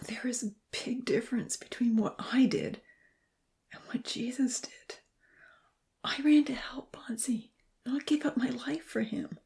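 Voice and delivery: high, breathless whisper full of anguish. A narrator's reading. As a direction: whisper it as if it hurts to say. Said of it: "In an anguished whisper".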